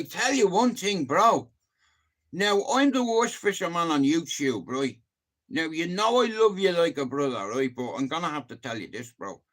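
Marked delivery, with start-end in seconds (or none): none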